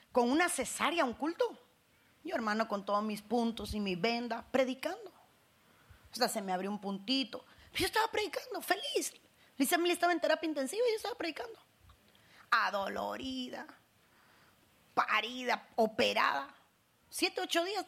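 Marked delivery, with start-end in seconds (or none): none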